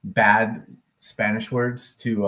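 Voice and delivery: normal talking voice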